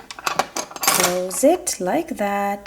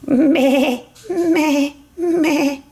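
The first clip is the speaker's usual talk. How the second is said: bleating meh meh meh; sheep bleat; 4 semitones higher, much slower than usual. Acted. Toy mother sheep answers, bleating.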